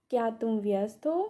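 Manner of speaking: normal talking voice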